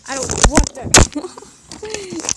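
very loud, high, drawn-out yell; mad outburst. Real, not acted. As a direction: explode into a long angry scream. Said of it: "That's what happens when I'm mad".

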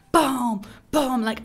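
booming imitation. Imitating the explosions.

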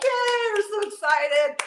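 high, loud hammed-up cheer; playful fake excitement; mock cheer. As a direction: cheer it like a clueless fan. Cheering oblivious to the fire.

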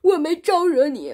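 loud, high indignant yell; angry shout. Acted. Monster protesting to Wukong, loud and indignant. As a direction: shout back in outrage. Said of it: "他就跑出来说".

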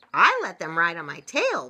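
loud, proud storybook boast. A narrator's reading. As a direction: announce it with a touch of pride. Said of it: "Beaver said proudly".

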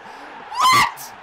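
very high, loud cracked shriek; stunned disbelief. Real, not acted. Gamer as the rival equalises, shrieking disbelief.

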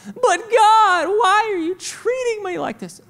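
high-pitched mock wail, loud at first; childish protest. Believer protests to God about being corrected, high mock wail. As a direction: cry out like a wronged kid.

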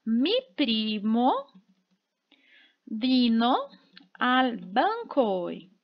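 very slow and spaced out, with vino dropped to a whisper; slow dictation. Shown as a demonstration. Teacher modelling the example sentence for students, very slowly. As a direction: lay each word down for the class. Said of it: "eu vou dizer assim".

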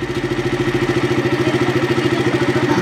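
breathy, voiceless chuckling; laughter. Real, not acted. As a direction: laugh in a run of short bursts. Laughing during the bike ride.

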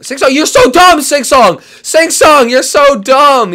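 high, loud scolding shout; exasperated yelling. Real, not acted. Player yelling at Sing Song over a blunder, exasperated. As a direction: berate a friend at full volume.

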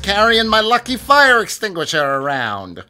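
high, loud character boast; hammed-up game character. Player voices his character addressing Sal, hammed-up boast. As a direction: project it high and proud, hamming it up.

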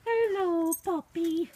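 high, drawn-out cooing hello; pet greeting. Real, not acted. Greeting the puppy in snow, high cooing.

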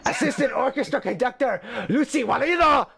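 mock-grand Japanese-accented credit; horrible Japanese accent. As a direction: proclaim the name in a fake Japanese accent. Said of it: "doing a horrible Japanese accent".

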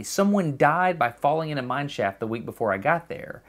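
ordinary talk

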